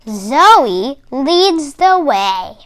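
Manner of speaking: ordinary delivery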